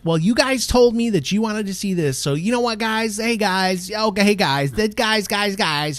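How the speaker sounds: high, loud, relentless vlogger chatter; mock YouTuber. Host mocking YouTubers addressing their viewers, hammed-up and pushy. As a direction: pile it on like an overeager YouTuber. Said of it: "they'll be like".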